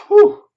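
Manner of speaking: loud, high exhale of relief; big relieved whew. Retold or imitated. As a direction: blow out a huge whew. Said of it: "Leo goes".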